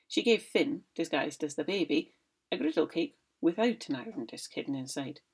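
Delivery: ordinary delivery, nothing marked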